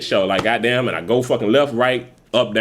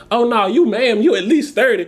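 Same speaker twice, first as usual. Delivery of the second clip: sassy youngster; 8 semitones higher than usual. Retold or imitated. Voices youngster teasing an older woman.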